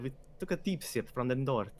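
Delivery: a stab at a French villain accent; attempted French accent. Shown as a demonstration. GM trying out Zagana's voice, a stab at a French accent. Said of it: "That's not French".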